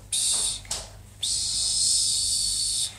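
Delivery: soft unvoiced shush to a sleeping baby. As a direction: shush the doll quietly to sleep. Puppen werden zum Schlafen gebracht. (leises Pst-Machen)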